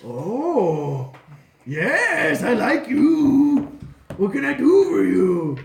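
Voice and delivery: loud, slow, swooping villain voice; hammed-up royal delight; pleased dragon king. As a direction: purr "oh", then swell grandly. Dragon king welcomes flatterer.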